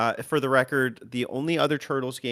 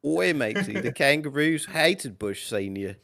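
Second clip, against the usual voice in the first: put-on slow, jokey Australian accent with an "Oi, matey"; mock Aussie accent; 2 semitones higher, slower than usual. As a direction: ham up an Aussie bloke. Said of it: "I'll be Australian".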